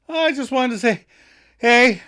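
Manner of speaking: high, loud mock-awkward hello; acted nervous greeting. Chandler greets the woman he secretly loves, high and loud. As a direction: pitch it up, bursting out on the last word.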